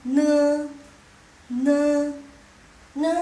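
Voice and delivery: "ne" held long and loud; teacher drilling "ne", each one separate; demonstrated consonant. Shown as a demonstration. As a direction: sound out "ne" slowly and firmly. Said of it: "Next, ini ne".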